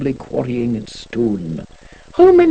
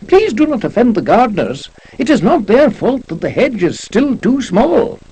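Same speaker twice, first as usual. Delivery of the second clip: a touch of a fretful servant, slightly raised; upset janitor; 7 semitones higher, 4 dB louder than usual. Acted. Janitor begs poet not to offend gardeners, mildly upset. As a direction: plead mildly on behalf of the gardeners. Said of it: "much upset".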